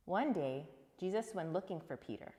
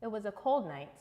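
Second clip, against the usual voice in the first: plain reading voice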